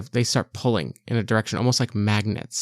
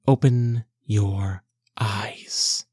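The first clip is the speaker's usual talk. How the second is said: slow eerie whisper in her head; ghostly whisper; much slower than usual. Acted. Goddess Namira whispering to Cassidy in her head. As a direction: breathe it like a spirit.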